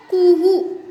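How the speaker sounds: high, loud cuckoo call "Kuhu". Shown as a demonstration. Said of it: "sound of cuckoos?".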